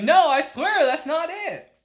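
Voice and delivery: loud denial. Retold or imitated. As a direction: plead your innocence loudly. Ko protests to Akira.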